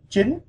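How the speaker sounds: "jin" climbing steeply to a high pitch; rising tone. Shown as a demonstration. Teacher demonstrating Vietnamese nine, "jin" with rising tone. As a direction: raise the voice right up on "jin". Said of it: "you raise your voice".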